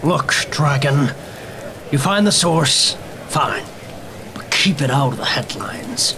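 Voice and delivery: lightly voiced weary cop; gruff police captain. Captain talking to the detective over the bodies, a lightly voiced weary cop. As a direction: play the tired captain at the scene.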